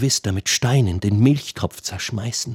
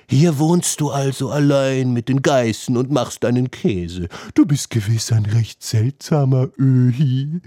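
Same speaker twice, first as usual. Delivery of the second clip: put-on voice of a stroppy youngster; needling youth. A narrator's reading. Youth taunting the lone goatherd, stirring trouble. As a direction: play a lad picking a fight. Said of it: "Der Jüngling fing gleich an zu stänkern".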